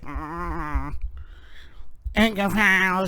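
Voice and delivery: a hint of a mumbling old woman; lightly voiced elderly politician; mumbly old lady, not far from his own voice; old woman impression. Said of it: "she, like, talks like this".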